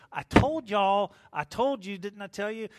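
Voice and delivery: Paul rubbing it in, I told you so; in your face. Retold or imitated. Paul gets in the crew's face, loud scolding. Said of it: "Paul gets in his face".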